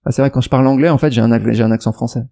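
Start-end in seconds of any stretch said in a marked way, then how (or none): none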